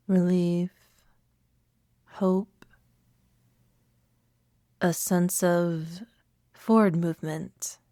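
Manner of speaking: unhurried meditation guide with long pauses; calm, spacious meditation voice; guided meditation. Acted. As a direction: lead listeners gently inward, leaving room to breathe.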